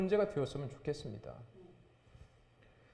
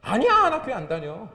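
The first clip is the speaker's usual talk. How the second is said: loud, raised mock protest; acted flustered denial; 6 semitones higher, 10 dB louder than usual. Embarrassed believer caught praying at a meal, exaggerated denial. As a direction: deny it hastily, hammed up.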